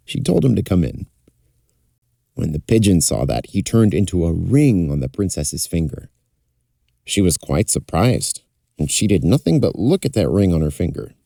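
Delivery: ordinary delivery, nothing marked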